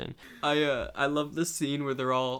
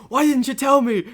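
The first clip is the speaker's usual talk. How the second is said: mock outraged shout; 13 semitones higher, 14 dB louder than usual. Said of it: "He's like".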